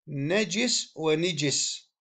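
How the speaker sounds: نجس said slowly as nejis then nijis, i under the jim; drawn out نجس ونجس with kasra on the jim. Shown as a demonstration. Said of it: "مع كسر الجيم". (نجس ونجس بكسر الجيم ببطء)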